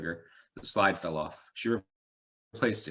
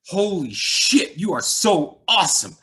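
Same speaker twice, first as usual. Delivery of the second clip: loud, high, drawn-out gushing; sputtering excitement; 11 semitones higher, 12 dB louder, slower than usual. A narrator's reading. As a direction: gush like a thrilled fat cop. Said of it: "He was sputtering".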